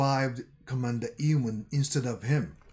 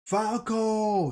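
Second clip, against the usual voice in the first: retold scream; 8 semitones higher than usual. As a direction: yell the name in alarm. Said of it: "someone screams".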